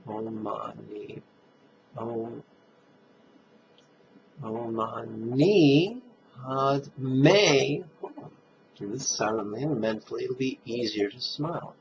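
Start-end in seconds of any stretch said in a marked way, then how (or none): 0.1-8.3 "Om Mani Padme Hum" very slow, with "ni" of "Mani" stressed; emphasized mantra. Shown as a demonstration. Teacher demonstrating which syllables of the mantra to stress, slow. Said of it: "Emphasize the syllable may and the syllable ni".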